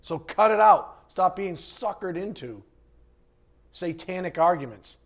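loud, forceful telling-off; stern scolding. Retold or imitated. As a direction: scold them to cut it out. Acted scolding of arguing believers.

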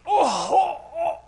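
high-pitched groans of a struggling runner; mock exhausted groaning. Runner suffering through a road race, mock groaning. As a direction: groan as if the race is killing you. Imitates his struggle while running.

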